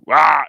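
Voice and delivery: loud, sudden mock roar; imitated monster lunge. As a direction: burst out like a pouncing monster. Imitates monster lunging in film scene.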